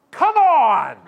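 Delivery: explosive high yell; unleashed outburst. Acted. Canadian swearing at rude flight attendant, huge mock-furious shout. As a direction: bellow it like the worst swear word. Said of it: "and I unleash".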